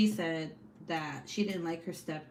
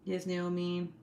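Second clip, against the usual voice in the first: ordinary talk, nothing marked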